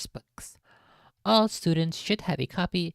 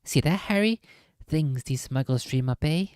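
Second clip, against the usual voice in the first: usual speaking voice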